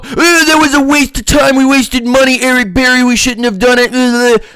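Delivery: high, whiny mocking fan voice; mock the fickle fans, high and whiny. Mocking fickle Falcons fans in a high whiny voice. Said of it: "then they go".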